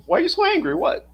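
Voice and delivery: voicing the dog. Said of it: "She'll be like".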